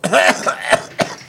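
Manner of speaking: loud coughing into the mic; violent cough. Real, not acted. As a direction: hack loudly. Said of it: "arrêter une seconde de tousse violemment dans le micro".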